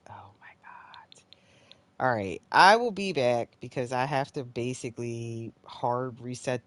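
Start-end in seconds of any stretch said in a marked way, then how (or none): 0.1-1.2 barely audible, voiceless; exasperated whisper. Real, not acted. Player giving up on the glitch, whispering. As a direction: whisper it in disbelief. Gives up on the game.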